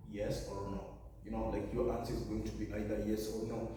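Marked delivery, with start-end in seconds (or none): none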